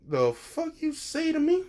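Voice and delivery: mock outraged Ida. Ida snapping back at the rude classmate, high mock outrage. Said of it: "Ida is like".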